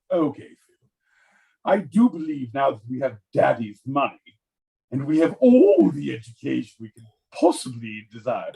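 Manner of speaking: swooping, affected British aristocrat; hammed-up British condescension, stretching words; snobbish British brothers. Rich brothers discussing poor Georgie, snobbish British.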